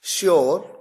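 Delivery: "श्योर" begun with a clear sh; careful hushing opening on "श्योर"; sh despite spelling. Shown as a demonstration. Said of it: "उच्चारण में शलगम वाला श है".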